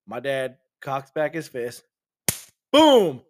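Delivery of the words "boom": high, loud burst of a shout; punch sound. Acted. Telling how dad's fist hits the dog, loud shouted boom. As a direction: slam the word out like the punch lands.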